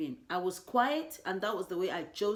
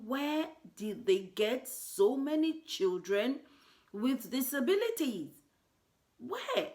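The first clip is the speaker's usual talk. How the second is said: distressed disbelief; 7 semitones higher, 4 dB louder than usual. Retold or imitated. Retold shocked thought at party.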